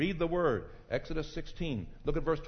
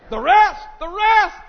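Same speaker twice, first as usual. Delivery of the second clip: high, loud excited cry; 16 dB louder than usual. Real, not acted. Preacher thrilled about rest, calling to the congregation. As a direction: burst out with joy at the word. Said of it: "I'm the only one excited".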